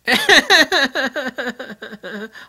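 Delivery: loud, high burst of real laughter; a genuine burst of laughter. Real, not acted. Laughing about kilt idea.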